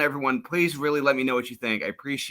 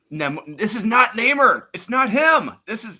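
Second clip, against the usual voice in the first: real frustration, higher and louder; exasperated outburst; 8 semitones higher, 6 dB louder than usual. Real, not acted. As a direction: snap out an annoyed correction. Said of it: "I am beyond pissed at this point".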